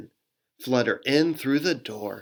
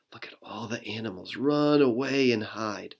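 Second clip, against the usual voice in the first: ordinary delivery, nothing marked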